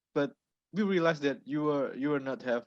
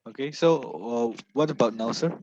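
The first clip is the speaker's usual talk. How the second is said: louder, projecting clearly; speaking up; 4 dB louder than usual. Real, not acted. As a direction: raise your voice so all can hear. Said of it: "can you repeat that?".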